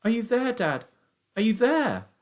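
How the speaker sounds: put-on high, pleading boyish call; shouting. A narrator's reading. Son calls out for missing father, shouting. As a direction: call high into the silent wood. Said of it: "I shouted".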